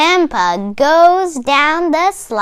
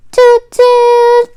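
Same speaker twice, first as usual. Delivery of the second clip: train toot; 9 semitones higher, 5 dB louder than usual. Acted. Train horn sound.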